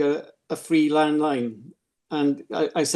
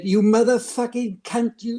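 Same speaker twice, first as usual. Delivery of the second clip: higher, slow mock outburst; retold insult; 7 semitones higher than usual. Caller phoning back, higher slowed mock abuse. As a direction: spit out the insult, pitched up and slowed. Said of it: "and she said".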